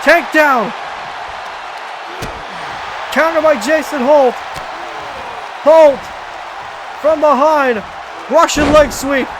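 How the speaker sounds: excited play-by-play, rising pitch; calling the match live, pumped up; wrestling commentator. Acted.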